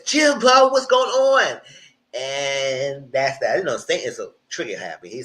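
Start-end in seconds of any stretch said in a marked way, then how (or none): 0.0-1.6 high, loud mock exclamation; retold outcry. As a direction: call out in disbelief, imitating him. Retold quote, alarmed challenge.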